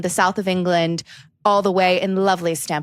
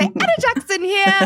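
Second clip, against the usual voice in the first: high, slow posh presenter imitation; Anna Jackson; 10 semitones higher than usual. Host shows Anna how she says it, high posh. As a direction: draw out a posh British sign-on. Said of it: "you say".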